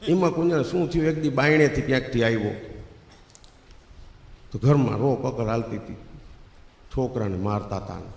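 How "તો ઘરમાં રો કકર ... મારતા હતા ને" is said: ordinary talk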